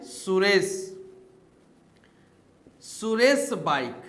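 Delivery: Suresh bike drawn out, s hissing; slow hissing example. Shown as a demonstration. Teacher slowly models the hiss in Suresh bike. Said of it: "sound create होता है किसका? Hissing".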